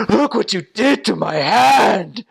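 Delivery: high, loud mock agony, ending in a gasp; writhing in pain. Injured man showing his hand to missionaries, mock agonized. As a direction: wail it out in exaggerated pain. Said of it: "like, writhing in pain".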